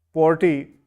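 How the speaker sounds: ordinary talk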